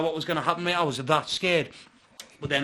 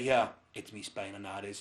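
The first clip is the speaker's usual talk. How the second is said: grim and low; 7 semitones lower, 5 dB quieter than usual. Retold or imitated. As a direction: drop it low, resigned. Retold grim realisation.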